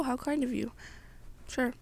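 plain reading voice

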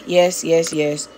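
usual speaking voice

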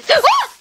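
sudden high gasp of shock; sharp loud gasp. Real, not acted. Player startled by a creeper, sharp loud gasp. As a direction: gasp as if ambushed.

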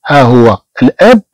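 ordinary talk

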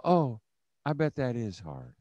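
soft, gentle oh of sympathy; just listen and soothe quietly; sympathetic. Shown as a demonstration. Leaders shown how to answer a struggling mom, soft sympathy. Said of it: "You just go".

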